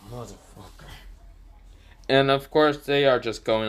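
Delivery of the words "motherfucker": muttered curse. Acted. Naruto groans as Betty joins.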